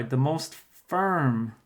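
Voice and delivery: the most firm drawn out very slowly; teacher to class, spelling out the clue very slowly; slow, clear reading of the clue. Shown as a demonstration. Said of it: "So just to clarify".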